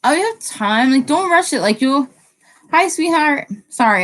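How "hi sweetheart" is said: talking through a yawn. Real, not acted. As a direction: greet mid-yawn. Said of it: "I'm, like, yawning".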